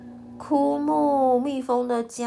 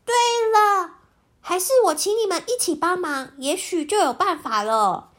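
put-on excited child voice; 6 semitones higher than usual. Jisaiya gets an idea.

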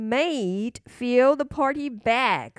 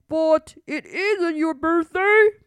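slow, louder royal father; king voice; 5 semitones higher, 6 dB louder than usual. Acted. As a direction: speak like a firm king. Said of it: "said the king".

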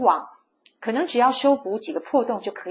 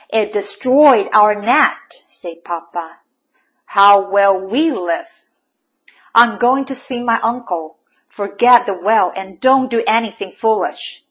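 stern scolding; 8 dB louder than usual. A narrator's reading. Father scolding his son over the ruined net. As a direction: scold the boy firmly.

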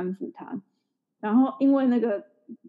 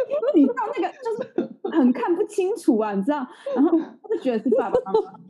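real laughter, higher, fast at first; laughing while talking; 11 semitones higher, 4 dB louder than usual. Real, not acted. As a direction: talk through helpless laughter. Retelling the embarrassing mix-up.

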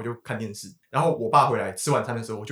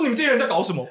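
raging father; 10 semitones higher, 4 dB louder, faster than usual. Retold or imitated. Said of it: "直接暴怒对我骂".

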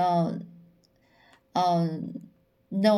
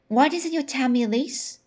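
ordinary delivery

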